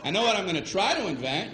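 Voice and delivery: usual speaking voice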